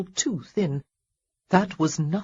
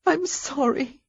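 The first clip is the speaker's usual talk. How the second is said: high, tearful and gasping; sobbing apology; 8 semitones higher, slower than usual. Acted. Heroine apologising while she sobs, tearful. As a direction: choke out the apology through sobs. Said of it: "gasping for breath between the sobs".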